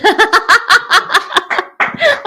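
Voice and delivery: bright burst of high laughter. Real, not acted. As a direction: laugh at the daughter's try. Daughter's funny attempt.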